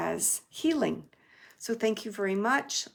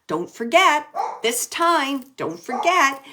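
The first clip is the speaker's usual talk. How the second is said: louder and higher, insistent; a relayed nudge, louder and higher; insistent reminder; 5 semitones higher, 7 dB louder than usual. Retold or imitated. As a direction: urge it like a firm reminder.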